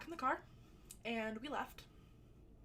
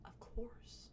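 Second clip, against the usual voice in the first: whispered; 5 dB quieter than usual. Retold or imitated. Friends offer her the blunt.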